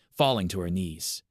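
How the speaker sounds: usual speaking voice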